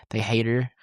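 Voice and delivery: ordinary talk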